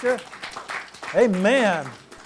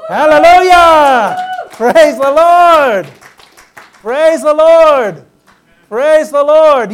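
high, loud, drawn-out hallelujah; joyful shout of praise; 5 semitones higher, 10 dB louder, slower than usual. Real, not acted. Pastor rejoicing before the congregation, shouting praise.

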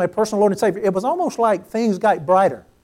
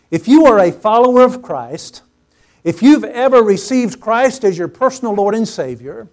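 usual speaking voice

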